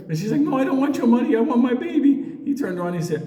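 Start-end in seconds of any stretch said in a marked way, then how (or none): 0.4-2.1 put-on high, insistent old lady voice. Old lady refusing Cameron's cash: high and insistent. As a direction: refuse the money, cling on.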